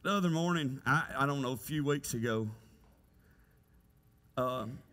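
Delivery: ordinary talk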